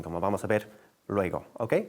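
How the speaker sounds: usual speaking voice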